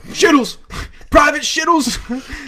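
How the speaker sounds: shouted military roll call; barking drill sergeant. Retold or imitated. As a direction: bark the name like a drill sergeant. Superior officer shouting at recruit.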